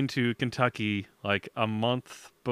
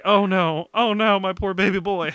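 louder, higher mock wail over her baby boy; imitating worried mother; 6 semitones higher, 5 dB louder than usual. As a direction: ham up a distraught mom. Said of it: "And she's like".

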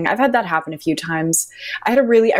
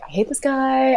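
higher, exasperated complaint; pitched-up fed-up moan; 7 semitones higher than usual. Retold or imitated. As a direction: whine it out in frustration. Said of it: "I was like".

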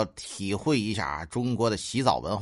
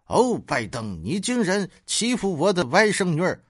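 higher, mock-outraged scolding; acted indignation; 6 semitones higher than usual. Aunt confronting Biden over her niece, indignant. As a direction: confront him with hammed-up indignation.

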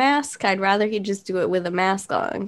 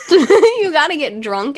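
laughing; 5 semitones higher, 6 dB louder than usual. Real, not acted. Laughing while speaking.

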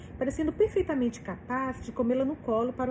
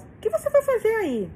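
much higher, louder alarmed question; raised voice; 11 semitones higher, 5 dB louder than usual. A narrator's reading. Frane on the phone demanding why Miranda is there, raised voice. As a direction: voice jumps up in disbelief. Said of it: "diz Frane, erguendo a voz".

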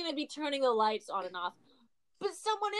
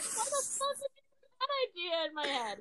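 mock outrage; 10 semitones higher, 4 dB quieter than usual. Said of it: "I was like".

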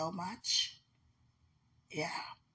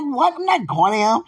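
surprised refusal; 10 semitones higher, 13 dB louder than usual. Retold or imitated. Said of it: "I'm like".

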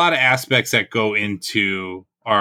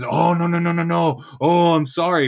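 high, singsong mock apology; hurried string of pleading refusals, put-on and higher; imitated backpedaling; 6 semitones higher than usual. Sergeant backing down after being challenged, mock-apologetic.

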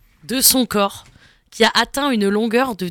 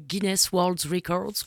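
put-on English accent; 3 semitones lower, 10 dB quieter, much slower than usual. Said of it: "Très bel accent anglais".

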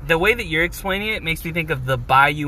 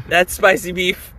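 ordinary talk

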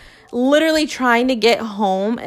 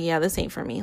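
deadpan; 5 semitones lower, 7 dB quieter than usual. Retold or imitated. Quitting running, deadpan.

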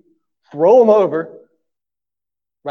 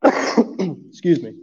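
cough; much slower than usual. Real, not acted. Preacher coughing mid-sermon, real cough. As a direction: cough and apologize. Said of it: "if I cough".

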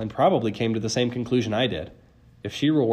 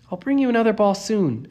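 high, wistful promise; faint promise; 11 semitones higher, 4 dB louder than usual. A narrator's reading. Girl parting from Cerberus, faintly promising. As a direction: promise it with little conviction. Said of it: "Annabeth promised faintly".

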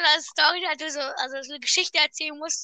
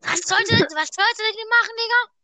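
baffled; 6 semitones higher, 4 dB louder than usual. Real, not acted. Baffled question rising.

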